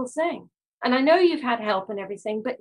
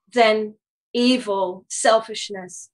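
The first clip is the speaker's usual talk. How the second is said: ordinary talk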